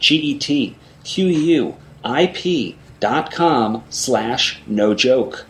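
slow letter-by-letter spelling; spelled out slowly. Shown as a demonstration. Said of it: "Let me spell it out for you".

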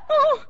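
sharp loud frightened gasp; startled gasp. Acted. Startled by sudden intruder.

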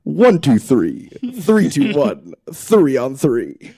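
segment jingle. Shown as a demonstration. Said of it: "sorry, I didn't say the thing".

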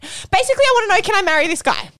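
high, fast mock-swooning question; giddy blurting. Jokingly blurts out her real question.

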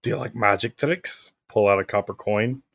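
ordinary talk, nothing marked

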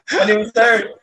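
ordinary talk, nothing marked

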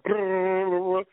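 high trilled buzzing noise; drawn-out "brrr" sound effect; imitated power surge. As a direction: trill like lights going haywire. Imitates lights going full power.

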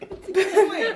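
high, loud laugh through the words; cracking up mid-sentence. Real, not acted. Group cracks up at joke.

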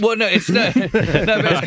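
laughs the words out, slowed and high; can't hold back laughter while answering; laughing. Real, not acted. Co-host laughing at the plugging joke, laughing.

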